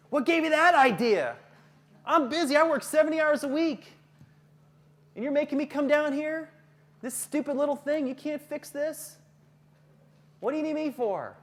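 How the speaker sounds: loud, high-pitched irate parent rant; indignant parent. Acted. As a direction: act out an exasperated parent complaining. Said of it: "then the parent might go".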